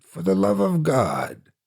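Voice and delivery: mocking echo. Narrator echoing the trapped man's prayer, mocking. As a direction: throw his words back at him.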